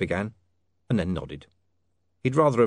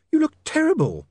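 very high, louder startled lady; alarmed young woman; 5 dB louder than usual. Acted. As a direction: exclaim in a high shocked woman's voice. Sacharissa shocked at Otto's look.

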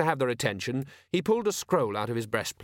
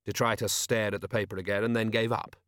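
usual speaking voice

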